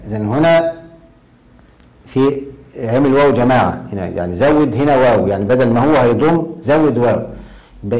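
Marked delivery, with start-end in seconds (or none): none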